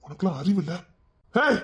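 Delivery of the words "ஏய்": shrill high rebuke; scolding yell. Acted. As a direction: berate her, rising to a shriek. Scolding the woman.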